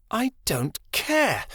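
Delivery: high, slow, cutting snap; snapped. A narrator's reading. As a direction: snap it at the guard. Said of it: "Chameleon snapped".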